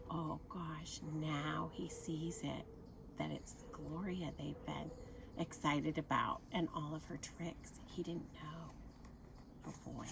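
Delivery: quiet oh-no aside; hushed aside. Real, not acted. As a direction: lean in and murmur the sad discovery. Aside as Buckle discovers truth.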